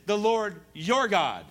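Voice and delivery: "your" drawn out and punched; stress on "your". Shown as a demonstration. Preacher quotes Saul distancing himself, heavy stress on "your". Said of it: "He doesn't say, "Our Lord".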